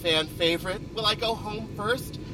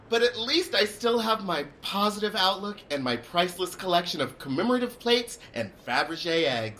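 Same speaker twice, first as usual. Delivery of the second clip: ordinary talk, nothing marked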